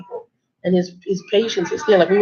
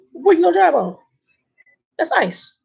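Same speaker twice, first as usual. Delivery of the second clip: high pitched disbelief; can you believe this; incredulous; 10 semitones higher than usual. Retold or imitated. Trucker asks trainee what she'd drive on, high incredulous. Warning the trainee about ice.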